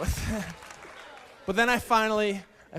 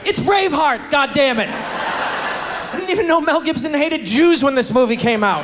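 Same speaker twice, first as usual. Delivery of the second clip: furious rant; 3 semitones higher, 6 dB louder than usual. Acted. Ranting at Blockbuster.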